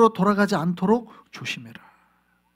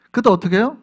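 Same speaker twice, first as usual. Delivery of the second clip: normal talking voice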